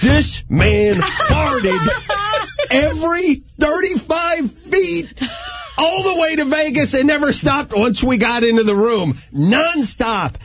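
high-pitched, slow, emphatic disbelief; mock outrage. Comic recounts the van ride to Vegas, mock outrage. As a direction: stretch out each phrase in exasperated amazement. Outraged telling of endless farting.

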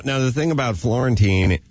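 ordinary delivery

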